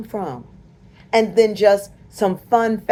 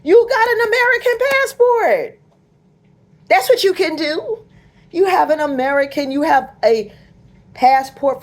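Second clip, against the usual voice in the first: much higher and louder, incredulous; high, loud mocking retort; exasperated high shout; 9 semitones higher, 4 dB louder than usual. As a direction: yell it back at them in disbelief. Mocking the panicked, shouting back.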